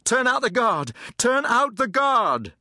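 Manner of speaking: high, urgent calling out; shouted call for help. A narrator's reading. Cornered soldier calls to the guardhouse, shouting. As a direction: call across the crowd for backup.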